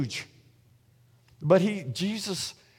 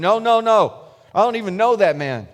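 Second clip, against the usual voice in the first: loud, higher emphatic protest; acted denial; 5 semitones higher, 7 dB louder than usual. Peter denies Jesus a second time, acted louder protest. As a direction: insist louder, pitched up, as if cornered.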